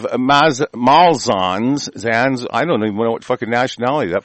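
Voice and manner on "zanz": normal talking voice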